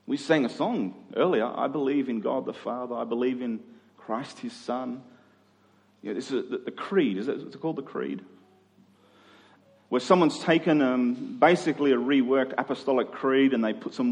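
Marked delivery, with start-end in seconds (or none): none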